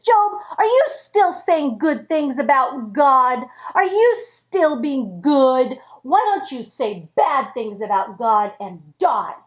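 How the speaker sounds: plain reading voice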